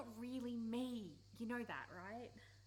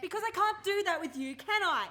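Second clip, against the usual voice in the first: loud, high, pained cry; shouting a confession at a best friend; anguished outburst; 8 semitones higher, 15 dB louder than usual. Acted. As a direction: blurt the truth out in frustration. Heated argument outburst.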